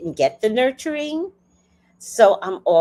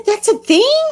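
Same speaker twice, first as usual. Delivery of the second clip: high, loud incredulous squeal; shocked disbelief; 8 dB louder than usual. Retold or imitated. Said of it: "And I said".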